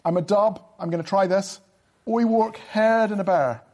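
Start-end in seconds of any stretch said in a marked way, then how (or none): 2.1-3.6 put-on broad Dublin accent with HARD as "haird". Shown as a demonstration. Guest trying a Dub line for the hosts, in a put-on Dublin accent. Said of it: "gonna try a Dublin accent".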